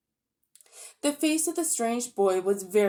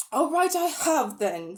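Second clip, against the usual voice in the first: put-on louder and higher, defiant boy who no longer cares; much louder voice; 6 semitones higher, 3 dB louder than usual. A narrator's reading. Diggory answering Polly's jibe, louder and higher. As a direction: blurt it out louder, not hiding he cried. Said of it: "in a much louder voice".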